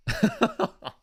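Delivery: high-pitched burst of laughter. Real, not acted. Host amused by crossbar trading card, high laugh. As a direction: crack up at your own joke.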